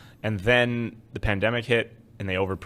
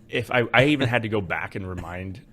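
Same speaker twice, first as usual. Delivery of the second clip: ordinary delivery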